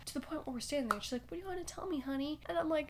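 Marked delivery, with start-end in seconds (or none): 1.3-2.4 high, soft grandma voice; kindly grandma. Retold or imitated. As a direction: imitate a grandma gently asking a child. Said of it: "her grandma actually".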